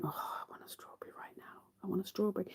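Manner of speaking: breathy longing whisper, quick; craving whisper. Retold or imitated. Eyeing breakfast strawberries, a longing whisper. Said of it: "I keep looking up going".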